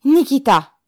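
high, loud summons; sharp calling-out; shouted call. A narrator's reading. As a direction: yell the name across a crowd. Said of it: "cria-t-il".

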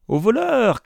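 high-pitched alarm cry; shrieking, very high call; cried out. A narrator's reading. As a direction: call for help against a thief. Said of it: "cria Isaac".

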